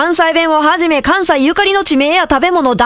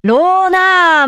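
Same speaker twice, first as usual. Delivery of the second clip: drawn out; 3 semitones higher, much slower than usual. Real, not acted. Stuck on the syllable ro.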